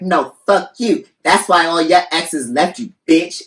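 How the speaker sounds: loud, high-pitched mock tirade; arguing woman. Host voices the second woman hitting back, mock furious. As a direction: act out her heated comeback, drawing out the first words. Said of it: "And she's like".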